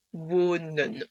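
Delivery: "wonen" slowly, long O then short final "eu"; long O, "eu" ending. Shown as a demonstration. Teacher giving the final result, long O and short E. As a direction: say "wonen" drawn out as "woo-neun". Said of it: "c'est d'office E".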